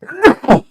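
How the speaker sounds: second sharp cough. Real, not acted. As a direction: cough hard again. Beg your pardon.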